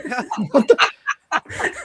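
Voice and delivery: high, loud, real laugh bubbling up; burst of laughter. Real, not acted. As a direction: crack up at the punchline. Bursts out laughing at own story.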